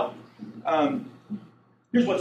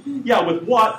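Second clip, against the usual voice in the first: higher, louder sarcastic comeback; sarcastic apostles; 8 semitones higher, 7 dB louder, slower than usual. Acted. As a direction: throw it back sarcastically. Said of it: "This is the sarcastic apostles".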